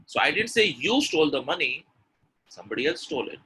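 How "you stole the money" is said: "you" raised above the rest; emphatic "you"; stress on you. Shown as a demonstration. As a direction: point the line at "you". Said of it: "the stress is on you".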